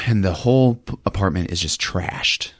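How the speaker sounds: normal talking voice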